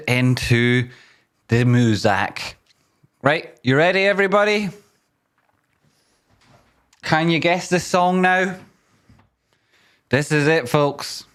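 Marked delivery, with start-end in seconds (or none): 3.2-8.6 hype call. Real, not acted. Hyping stream quiz round.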